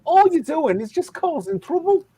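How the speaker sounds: Scouse accent. Shown as a demonstration. Friend argues back in character, high indignant Scouse. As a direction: scold in high-pitched Scouse. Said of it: "it's fucking Liverpool".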